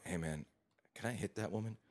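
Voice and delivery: hushed, confidential question; soft, voiced mock whisper; retold whisper. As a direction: murmur it softly into a friend's ear. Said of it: "I just, like, whisper in".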